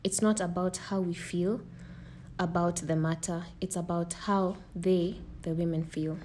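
earnest scripted statement; formal speech. Acted. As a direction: read it like a public announcement. Reading a prepared message.

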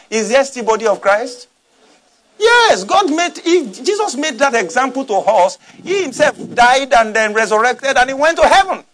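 ordinary talk, nothing marked

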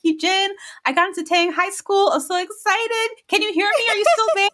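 high, excited call down the street. Retold or imitated. Girl calls to the boy down the street, high excited shout. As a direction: shout the good news after him, thrilled.

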